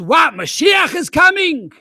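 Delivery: high, loud slow astonished cry; astonished shout. Retold or imitated. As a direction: shout in disbelieving joy. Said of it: "He says".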